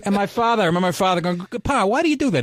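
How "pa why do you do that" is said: higher-pitched, pleading question to Pa; mock protesting son. Son asking his father about kicking the dog, mock protest. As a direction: imitate a baffled kid questioning his father.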